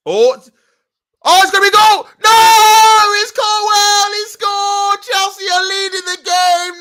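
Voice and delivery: high, loud goal scream; roaring live commentary; goal celebration shout. Real, not acted. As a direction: scream it like the goal just went in.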